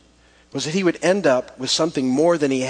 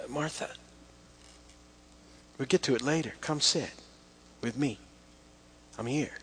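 gentle reassuring; 8 dB quieter than usual. Acted. Jesus answers Martha, acted.

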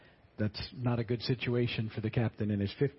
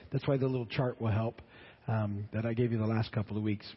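ordinary talk

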